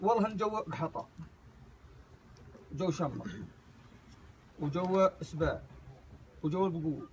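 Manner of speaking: low, hushed listing; quiet. Real, not acted.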